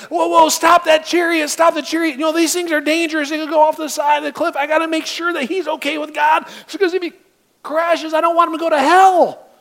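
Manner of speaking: high, loud panicked shouting; freaking out. Pretend. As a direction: yell in alarm to stop the chariot. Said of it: "freaking out on people".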